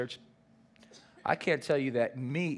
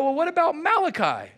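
much higher and louder, quick and eager; eager disciples; 11 semitones higher, 6 dB louder than usual. Retold or imitated. Disciples quizzing Jesus, high and eager. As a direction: keep pushing with the next question. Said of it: "And they go".

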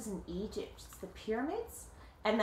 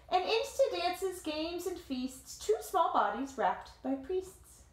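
lively read-aloud voice, higher than the chat; story narration; 7 semitones higher, slower than usual. A narrator's reading. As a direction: tell it like a picture book to kids. Reading the picture book aloud.

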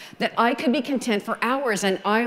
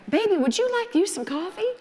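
much higher, sugary playful coo; coy sweet talk; 10 semitones higher than usual. Retold or imitated. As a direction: coo it at the boss like a flirt. Sweet-talking her boss over coffee.

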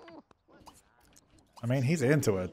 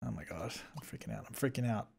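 very soft, low anxious muttering; hushed, worried self-talk; freaking out quietly; 4 semitones lower, 17 dB quieter, faster than usual. Real, not acted. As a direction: fret under your breath.

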